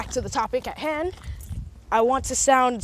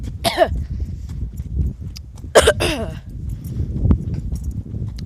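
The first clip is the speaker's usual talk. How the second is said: coughing fit; 3 dB louder than usual. Real, not acted. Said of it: "choked on my spit".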